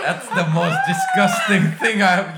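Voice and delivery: high, loud, laughing outburst; laughing disgust. Real, not acted. Co-host reacting to the video, loud laughing disgust. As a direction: exclaim loudly in amused disgust.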